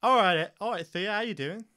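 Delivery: boisterous greeting. Acted. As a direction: boom a friendly hello at the kid. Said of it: "Esta looks over at her and goes".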